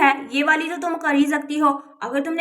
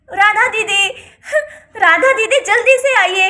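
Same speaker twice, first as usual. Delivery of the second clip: loud, urgent calling out; shouting; 3 semitones higher, 6 dB louder than usual. Acted. Aunt summons her elder sister to complain, shouting. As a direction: yell for help from another room. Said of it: "क्यों चिल्ला रही हो इतनी जोर जोर से".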